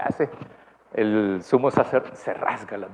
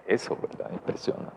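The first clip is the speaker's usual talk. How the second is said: hushed whisper; 4 semitones lower, 8 dB quieter than usual. Shown as a demonstration. Preacher whispering to the congregation in awe. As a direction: breathe it out unvoiced, almost secret. Repeats the word for effect.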